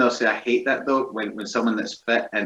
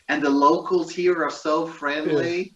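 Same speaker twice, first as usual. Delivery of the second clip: higher, drawn-out tourist gushing; mock vlogger; 7 semitones higher, slower than usual. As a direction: parody a presenter praising the locals, slowly. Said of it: "some guys like".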